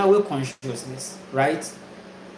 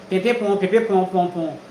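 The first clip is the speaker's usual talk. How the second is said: playful trumpet noise, voice shifted; a hint of a fanfare mocking doomsday; mock trumpet call. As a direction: sing it out like a toy trumpet blast. Imitating judgment day trumpet.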